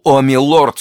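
high, loud cry; anguished exclamation. A narrator's reading. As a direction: cry out to the wounded duke. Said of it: "вскричал барон".

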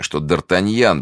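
ordinary delivery, nothing marked

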